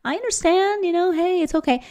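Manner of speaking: to her son, sugary high mock reassurance; mock soothing. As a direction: sweetly humor a sulking kid. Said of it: "I'm like".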